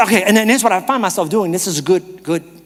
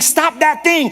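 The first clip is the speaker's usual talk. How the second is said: high, raised command; mock scream; 9 semitones higher, slower than usual. Shown as a demonstration. Preacher showing how God does not speak, mock screaming. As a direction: order it sharply, pitched up. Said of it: "and screaming into you".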